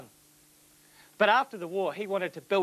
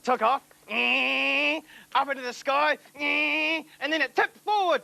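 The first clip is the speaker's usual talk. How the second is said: excited; 7 semitones higher than usual. Acted. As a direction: build the tension as it tips. Narrating plane's flight.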